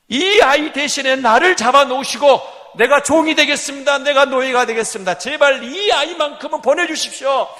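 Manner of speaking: loud, high shouting plea; desperate plea. Acted. Judah begging the governor to spare Benjamin, shouting. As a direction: beg at the top of your voice.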